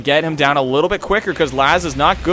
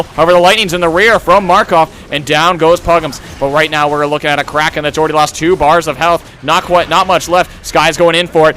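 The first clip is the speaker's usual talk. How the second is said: excited, raised esports commentary, surging at big moments; live play-by-play; 3 semitones higher, 4 dB louder than usual. Real, not acted. Caster calls lightning strikes and escapes to viewers, excited play-by-play. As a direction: call the match at full tilt.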